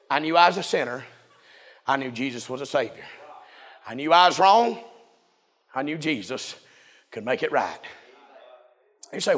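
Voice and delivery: low, soft delivery with long pauses; hushed preaching. Acted. Preacher telling how Jesus stopped for the sinner, hushed preaching. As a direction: drop right down after the climax and let each phrase sit.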